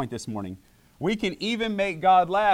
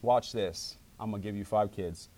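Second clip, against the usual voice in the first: God answering softly; 8 semitones lower, 6 dB quieter than usual. Retold or imitated. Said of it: "he says".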